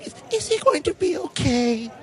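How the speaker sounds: put-on squeaky little old woman; old lady voice. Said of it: "Is that your woman voice?".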